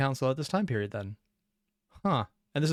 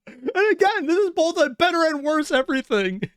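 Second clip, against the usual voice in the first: high-pitched, talking through laughter; real laughter lifting the voice; laughing; 12 semitones higher, 3 dB louder than usual. Real, not acted. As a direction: squeeze the words out while cracking up. Laughing hard at the clip.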